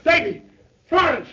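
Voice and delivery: husband calling his wife in panic. Acted. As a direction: shout for his wife across the house. Panic, baby missing, calling out.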